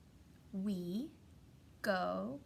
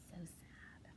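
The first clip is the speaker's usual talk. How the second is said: mock-sad whisper; 5 semitones lower, 16 dB quieter than usual. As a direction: whisper it like a tiny tragedy. Mourning torn book page.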